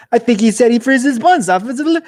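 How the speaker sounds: high, loud astonished exclamation; mock baffled viewer. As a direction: play the stunned Super Bowl crowd. Stunned viewers react to weird ad.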